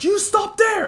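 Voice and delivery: high, loud stop command; commanding shout. Acted. Izuku yelling at the bulky man, high and loud. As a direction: yell to freeze the attacker.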